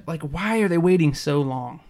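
ordinary delivery

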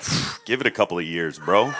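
low, soft and weary; mock weary scepticism; jaded veteran. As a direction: sigh it out like a tired old-timer. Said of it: "you're like".

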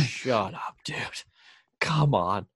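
hushed, breathy "shut up, dude"; hushed reply to a stranger teasing about the season; whispered retort. Retold or imitated. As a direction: whisper it back like a private retort. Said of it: "I was like".

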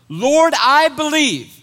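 loud, slow, high cry of faith; cried out. Acted. The father crying out to Jesus, loud and slow. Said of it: "He cried out".

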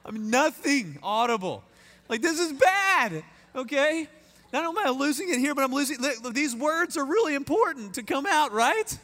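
high, strained voice of mock losing it; choked-up exasperation. Groom panicking that his vows won't come out, mock choked-up. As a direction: imitate a groom fighting back tears.

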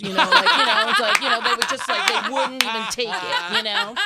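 loud, high talk broken by laughter; real loud laughter over the words; laughing hard. Real, not acted. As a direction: laugh your way through the memory. Laughing through the brothers story.

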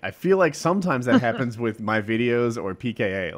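usual speaking voice

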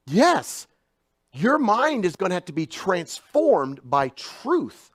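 high, loud preaching climax; full-force preaching. Acted. Preacher urging congregation, loud and high. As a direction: press the truth on them hard.

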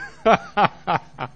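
a short loud laugh at the listener; teasing laugh. Pretend. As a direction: laugh at them playfully before the question. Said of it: "can I laugh at you and say".

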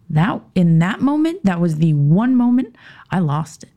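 usual speaking voice

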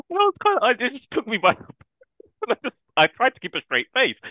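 high and loud through real laughter; real laughter breaking up the words; laughing. Real, not acted. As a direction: laugh while trying to keep it together. Laughing retelling the niece's line.

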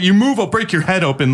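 high mock threat; mock cop threat. Imitating the hostile cop to the suspect. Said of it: "he says".

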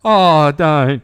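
loud, high, half-mock sobbing protest; mock wail. At his daughter's cheeseburger line, loud mock wail. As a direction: wail it like you are about to cry. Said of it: "I just went".

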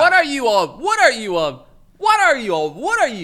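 loud disbelieving repeat; exasperated outburst. Real, not acted. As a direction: hammer the question at him, fed up.

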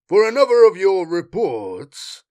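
high, loud, arch jab. A narrator's reading. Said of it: "Knox said archly".

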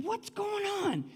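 high-pitched puzzled cry; mock bafflement. Soldiers wondering at another failed ambush, mock bafflement.

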